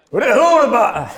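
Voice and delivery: high, very loud holler; Malachus. Acted. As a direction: bellow up from below, half laughing. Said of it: "Malachus down like".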